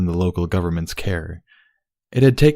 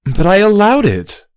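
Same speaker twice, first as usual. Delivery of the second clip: sharp retort; 14 semitones higher, 8 dB louder than usual. A narrator's reading. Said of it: "Celestia retorted".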